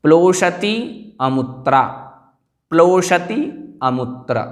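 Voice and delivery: प्लोषति rising, pause, अमुत्र falling; words split apart. Shown as a demonstration. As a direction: separate प्लोषति with full final i from अमुत्र. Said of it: "यहाँ पर संधि थी".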